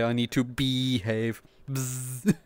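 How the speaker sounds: ordinary delivery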